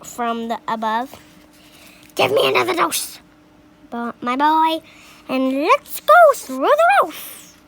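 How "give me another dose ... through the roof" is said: high, loud, fast demand; screechy old grandma. A narrator's reading. Grandma demanding another dose to go through the roof. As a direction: beg greedily for another dose.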